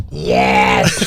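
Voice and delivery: very low, croaked yes into the mic; mock rasp. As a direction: croak it like a damaged throat. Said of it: "I went".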